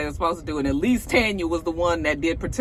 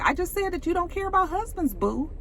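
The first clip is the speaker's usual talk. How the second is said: condescending; 10 semitones higher, 4 dB quieter than usual. Retold or imitated. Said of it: "very condescending".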